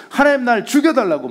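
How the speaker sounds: high, loud, fed-up demand; hammed-up angry pleading; angry plea. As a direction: imitate a furious prayer to God. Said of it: "화가 나가지고".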